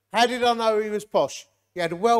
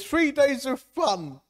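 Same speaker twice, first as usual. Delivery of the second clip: slow, drawn-out "Three days of fun"; mocking quote; 6 semitones higher, much slower than usual. Shown as a demonstration. Preacher reading the leaflet aloud, slowly and mockingly. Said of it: "I don't like that word fun".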